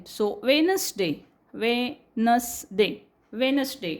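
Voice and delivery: slow वेनसडे with no D; silent D. Shown as a demonstration. Teacher slowly models the right वेनसडे. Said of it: "डी silent".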